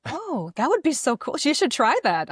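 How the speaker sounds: bright, high enthusiasm; light up at the idea; excited. Real, not acted. Excited about wife voice acting.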